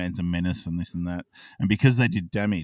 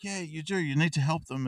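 small pitched-up mock urging; pleading cherub; 8 semitones higher than usual. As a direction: lightly voice a good angel urging. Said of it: "cherubs are fighting on his shoulders".

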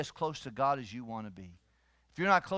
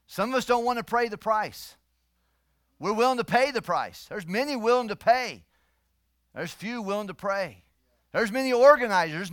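loud, raised-pitch sermon voice; full-force preaching; 5 semitones higher, 10 dB louder than usual. Real, not acted. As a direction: drive the point home to the congregation.

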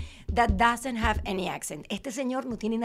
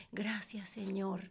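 mock prayer; 3 semitones lower, 10 dB quieter, slower than usual. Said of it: "se burlan mis compañeras, porque dicen".

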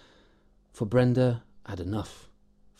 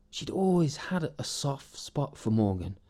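ordinary delivery

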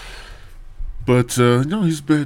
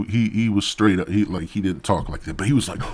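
normal talking voice

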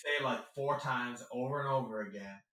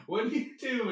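high, bubbly interviewer voice; overly sweet chirp; mock-cheerful question; imitating actor; 11 semitones higher, 6 dB louder than usual. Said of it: "he's like".

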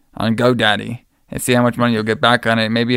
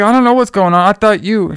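high, louder mock panic; imitated freak-out; 7 semitones higher, 4 dB louder than usual. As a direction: play her flustered and accusing, pitched well up. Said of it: "She's, like, freaking out".

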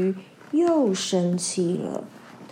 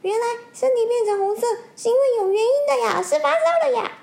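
much higher and fast, sing-song; high fish voice; 13 semitones higher, 4 dB louder, much faster than usual. Acted. Fish announcing the fever, high quick chirp. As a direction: pipe it up like a tiny excited fish.

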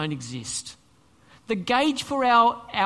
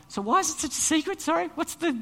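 puzzled questioner; 7 semitones higher than usual. Acted. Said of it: "everyone is now asking".

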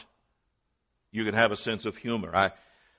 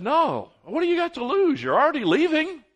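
much higher put-on voice for the friend; high comic voice, a touch louder; mock friend voice; 6 dB louder than usual. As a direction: squeak it out like a cheeky buddy.

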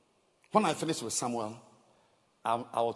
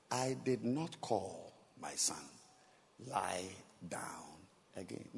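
put-on gentle old priest; 6 semitones lower, 8 dB quieter than usual. Eli sending young Samuel back to sleep, gently. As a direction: lower and softer, a touch slow.

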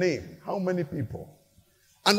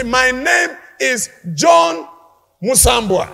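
proud name call; 4 semitones higher, 6 dB louder, slower than usual. Pretend. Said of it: "you will say".

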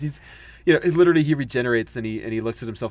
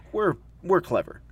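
smug; 6 semitones higher than usual. Shown as a demonstration. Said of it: "We're Americans who think we're better".

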